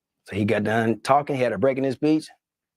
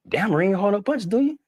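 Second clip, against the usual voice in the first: much higher, chummy mock-President voice; cheerful high-pitched President impression; imitating the President; 8 semitones higher than usual. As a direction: put on a folksy high presidential voice. Retelling the President's lines.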